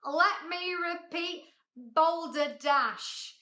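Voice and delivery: slow, pompous proclamation; booming shout. A narrator's reading. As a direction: stretch it out grandly and loud. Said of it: "he shouted again".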